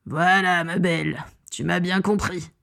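lightly voiced villain congratulating a pet; sinister, controlled approval; villain's praise. A narrator's reading. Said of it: "la félicita Piscus Crab".

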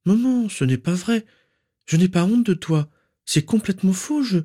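high, pleading daughter's voice; distressed protest. A narrator's reading. Narcissa insists she is not ashamed, distressed. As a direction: protest desperately, pitched right up.

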